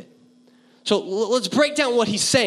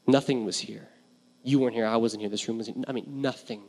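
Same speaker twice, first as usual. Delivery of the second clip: hushed preaching; 9 semitones lower, 6 dB quieter than usual. Acted. Pastor inviting the congregation to focus, hushed and soft. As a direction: draw the room into quiet awe.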